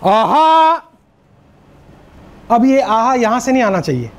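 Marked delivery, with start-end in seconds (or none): 0.0-0.8 bright, higher, louder aha from the belly; energetic crowd response aha; excited aha. Shown as a demonstration. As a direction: answer like a cheering crowd. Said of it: "तो आप सब बोलेंगे".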